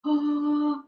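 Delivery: high, rushed panicky oh my gosh; imitating panic. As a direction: fret breathlessly. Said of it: "and it was like".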